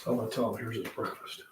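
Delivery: conspiratorial whisper to the camera; hushed aside. Real, not acted. Plotting prank on his son.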